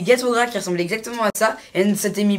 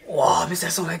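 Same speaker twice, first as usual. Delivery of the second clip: whispering; 5 semitones lower, 5 dB quieter than usual. Real, not acted. Whispers about the smoke.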